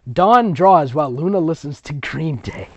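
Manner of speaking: ordinary talk, nothing marked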